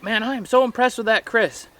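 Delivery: teasing high-pitched mom impression; imitating mom. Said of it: "My mom, that was one thing she said".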